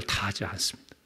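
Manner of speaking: hushed whisper. Shown as a demonstration. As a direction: whisper it softly to the congregation. Whispered answer, Jesus restrains himself.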